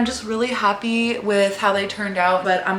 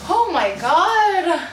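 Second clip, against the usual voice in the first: exasperated relief; 9 semitones higher, 5 dB louder, much slower than usual. Real, not acted. Relief after struggling with box.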